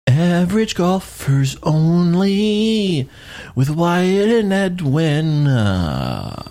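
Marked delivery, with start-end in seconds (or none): 0.1-5.5 announcer voice. Acted. Announcer-style show tagline.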